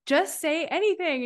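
high, lively quoted urging; replaying her own pep talk; retold urging. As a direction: coax her like back then. Retold coaching of friend.

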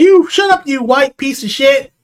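high, slow mocking insult; mock angry players. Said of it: "everyone's like".

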